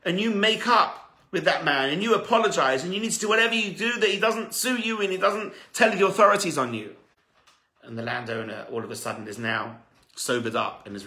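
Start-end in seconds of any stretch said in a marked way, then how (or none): 0.1-6.9 raised, pressing plea, slowed at the start; urgent pleading. Acted. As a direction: push him hard to make amends. Pleading figure in retold story.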